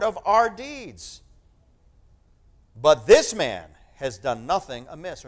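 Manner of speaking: slow, loud, weighty Bible reading; solemn scripture reading. Acted. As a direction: proclaim each verse from the pulpit. Reading the thief's rebuke.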